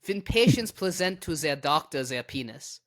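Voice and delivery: light mock German accent, "ze" for "the". Shown as a demonstration. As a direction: read "their doctor" as "ze doctor", German style. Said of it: "this needs to be read in a German accent".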